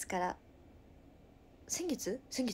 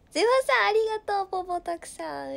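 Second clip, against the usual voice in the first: excited thanks; 9 semitones higher, 10 dB louder, faster than usual. Real, not acted.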